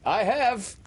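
put-on high, blithe boyish answer. A narrator's reading. Peter cannot resist the game, answering blithely. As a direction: toss it back blithely, pitched high. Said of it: "answered blithely in his own voice".

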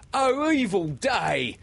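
high, drawn-out wail of a pirate; Starkey. A narrator's reading. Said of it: "cried Starkey".

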